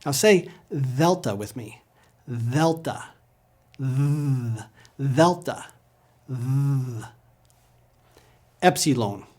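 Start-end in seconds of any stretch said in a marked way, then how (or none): 1.0-1.4 "delta" as "THEL-ta", voiced th; soft Greek D. Shown as a demonstration. Teacher asks learners to say it, soft th delta. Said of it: "A soft D will sound like T H". 2.3-7.0 "Delta" as "THEL-ta", then a long buzzed "Th"; soft Greek D. Shown as a demonstration. Teacher leads repetition, soft th delta. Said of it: "A soft D will sound like T H".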